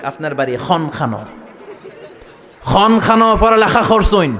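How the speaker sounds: hammed-up Sylheti man asking about home; Sylheti accent. Preacher imitates a Sylheti man on the plane, hammed up. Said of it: "সিলেটে এক লোক বসছে".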